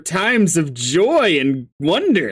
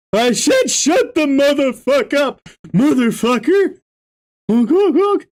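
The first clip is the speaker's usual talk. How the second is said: Gamzee voice; 9 semitones higher, 4 dB louder than usual. Acted. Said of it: "Gamzee's voice".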